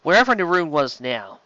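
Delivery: ordinary delivery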